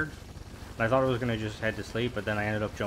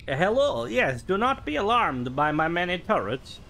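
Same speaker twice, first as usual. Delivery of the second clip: higher, louder hammed-up Borat accent; 8 semitones higher, 5 dB louder than usual. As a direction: greet them as a proud Kazakh trader in a Borat accent. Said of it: "I don't know why I do that accent".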